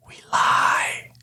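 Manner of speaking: breath-only stage whisper; confiding whisper. Acted. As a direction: whisper it like a trade secret. Confiding a little secret.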